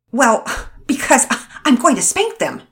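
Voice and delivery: angry mother declaring the spanking; in a huff. Acted. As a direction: blurt it out in a huff. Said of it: "declared in a huff".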